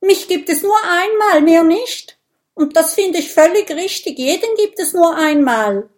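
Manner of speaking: high, loud, fast panicky rant; dismayed protest. A narrator's reading. Minimo insists there is only one of him, frantic. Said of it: "ruft Minimo bestürzt".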